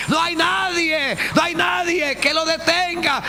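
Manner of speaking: high, loud and slowed; preached climax. Acted. Preacher proclaiming God's power, high, loud, slowed. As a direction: hammer out no hay nadie.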